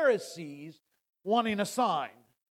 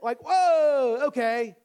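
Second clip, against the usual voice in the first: louder, higher whoa of realization; mock surprise; 5 semitones higher, 6 dB louder, slower than usual. Preacher playing the disciples catching on, mock surprise. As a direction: pop the whoa like a sudden dawning.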